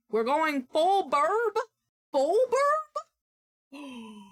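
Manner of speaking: high, slow, incredulous question; surprised. Real, not acted. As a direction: echo it back in disbelief. Surprised at the friend's phrase.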